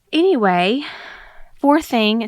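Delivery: breathless, eight months pregnant; out of breath, pushing the words out. Real, not acted. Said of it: "I'm out of breath".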